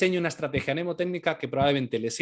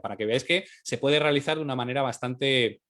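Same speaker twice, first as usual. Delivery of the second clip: ordinary talk, nothing marked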